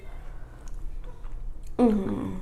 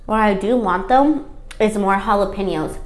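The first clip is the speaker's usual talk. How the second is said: ordinary talk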